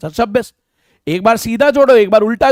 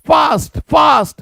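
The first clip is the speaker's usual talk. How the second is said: high, urgent call of fast; urging shout; 5 semitones higher, slower than usual. Real, not acted. Teacher urging the class to work faster. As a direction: push the students to hurry. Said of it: "urging students to hurry".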